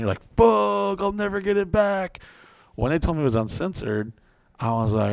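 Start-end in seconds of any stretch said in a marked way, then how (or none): 0.4-2.2 higher, hammed-up despair over the lost snap; mock dismay. To listeners, retelling losing the snap, mock dismay. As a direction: whine it like the snap is gone forever.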